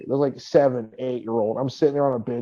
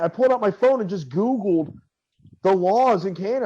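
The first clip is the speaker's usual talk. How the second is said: ordinary talk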